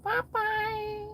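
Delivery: squeaky mock goodbye; falsetto goofball. Silly high voice.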